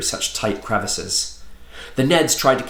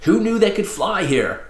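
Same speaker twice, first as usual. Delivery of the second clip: high, exasperated complaint; put-on grouchy disbelief; growled; 8 semitones higher than usual. A narrator's reading. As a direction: gripe it with raised pitch. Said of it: "Octo growled".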